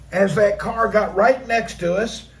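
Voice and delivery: usual speaking voice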